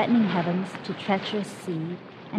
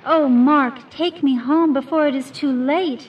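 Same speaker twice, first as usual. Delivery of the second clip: high, loud cry of despair; 7 semitones higher, 7 dB louder than usual. A narrator's reading. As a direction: wail a desperate plea. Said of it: "saying in a tone of despair".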